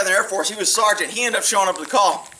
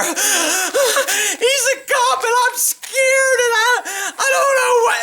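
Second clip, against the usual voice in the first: much higher, drawn-out fake sobbing whine; mock crying; 12 semitones higher, much slower than usual. Neighbor fake-crying to the police, hammed-up sobbing. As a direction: ham up a fake tearful plea. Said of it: "she starts crying".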